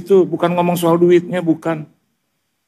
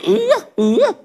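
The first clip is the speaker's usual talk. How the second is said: high mock grunting "uh, uh"; imitated grunts. Preacher mimicking his wife's reluctant reply, high mock grunts. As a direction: grunt back like a sulking wife.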